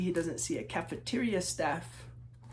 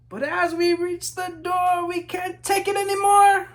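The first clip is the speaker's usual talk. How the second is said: rising higher and higher with excitement; excited build; 12 semitones higher, 10 dB louder than usual. A narrator's reading. Children reaching the playground door, excited rising build. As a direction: burst out like kids who can't hold still.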